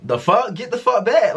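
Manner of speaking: high, sharp mock outrage at the man blocking her; imagined angry snap. To the guard stepping in front of her, a high indignant snap. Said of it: "I didn't say that".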